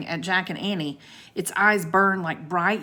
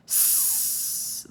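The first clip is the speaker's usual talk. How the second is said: long, sharp serpent hiss; angry hiss; 6 dB quieter than usual. A narrator's reading. Sea serpent warns the children, angry hiss. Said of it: "the serpent hissed angrily".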